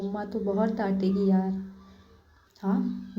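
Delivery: ordinary delivery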